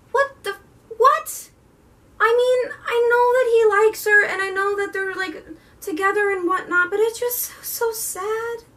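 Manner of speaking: high, pained what?; shocked then sad about a scene; shocked disbelief. Real, not acted. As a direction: squeak out the outrage, then sag into sadness. Shock at Cress left behind.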